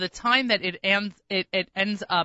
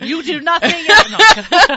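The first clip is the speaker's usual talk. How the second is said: playful teasing, higher and louder; mock accusing; 8 semitones higher, 11 dB louder, much slower than usual. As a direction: tease her about prop shopping. Teasing about prop shopping.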